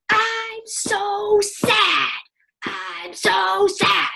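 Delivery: imitating a kid's huge, high, drawn-out wail; mock over-the-top cry, very slow; big exaggerated sad wail. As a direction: blow up a pretend sadness, stretched and high. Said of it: "it was seriously, like, that big".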